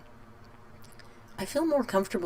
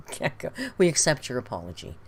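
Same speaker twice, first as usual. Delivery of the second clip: normal talking voice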